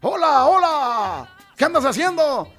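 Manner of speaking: a dog imagined speaking, slow and strange; talking dog. Retold or imitated. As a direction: lightly voice a dog that talks. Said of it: "el perro está hablando".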